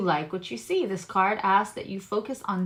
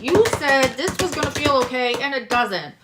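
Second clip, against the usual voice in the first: mildly indignant complaint, voice pushed louder; mock complaining email; 5 semitones higher, 7 dB louder than usual. Viewer complaining to the reader, in a mock indignant email. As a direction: play the annoyed viewer writing in. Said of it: "be like".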